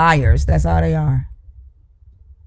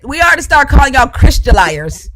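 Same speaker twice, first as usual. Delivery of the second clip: higher, emphatic, heated ranting; angry accusation; 4 semitones higher than usual. Real, not acted. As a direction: lash out at the opponent.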